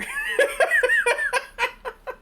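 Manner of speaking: big high-pitched burst of laughter; high loud laugh. Real, not acted. Laughing at a viewer's baffling request.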